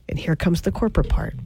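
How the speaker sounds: usual speaking voice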